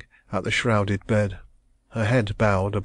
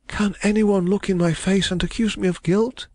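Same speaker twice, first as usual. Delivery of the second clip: put-on distressed young woman; 11 semitones higher than usual. A narrator's reading. Young woman protesting her love, high and anguished. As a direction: cry it out as the wronged heroine. Heroine's voice.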